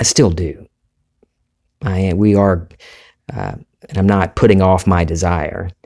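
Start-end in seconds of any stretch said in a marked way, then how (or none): none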